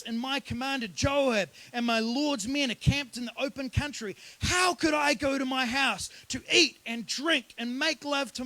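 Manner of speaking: high, forceful indignant protest; impassioned refusal. Retold or imitated. Uriah refuses the king's offer to go home, impassioned.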